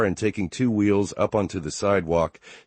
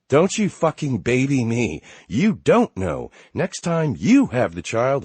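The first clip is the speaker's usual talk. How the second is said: higher, louder angry snap; 7 semitones higher, 5 dB louder than usual. Acted. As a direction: snap back at him. Wife snaps at husband.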